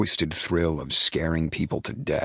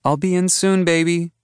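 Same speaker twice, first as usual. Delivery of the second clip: calling softly; 13 semitones higher, 5 dB louder than usual. A narrator's reading. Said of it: "Kirby calls softly".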